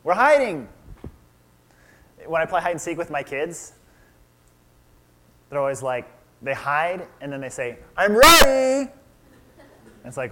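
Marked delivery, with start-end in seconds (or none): 0.0-0.7 put-on high, loud sing-song kid voice; like a child who thinks hiding works; childlike answer. Adam and Eve answer God. 8.0-8.9 put-on high, loud child's call; imitating his child in hide and seek; kid calling out.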